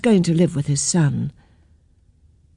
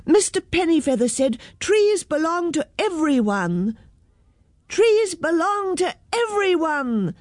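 chorus it high, without real volume; storyteller's high mock shout; children's shout; 9 semitones higher than usual. A narrator's reading. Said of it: "shouted all the children".

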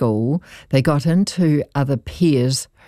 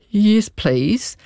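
put-on higher, as a crying boy's reply; imitated tearful plea; 7 semitones higher than usual. Crying boy answers the offer of a hug, mildly tearful. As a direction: lightly mimic a sobbing child accepting comfort. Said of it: "he could hardly breathe. He's crying".

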